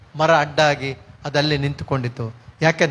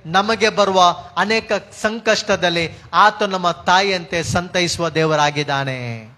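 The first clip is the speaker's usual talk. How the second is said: usual speaking voice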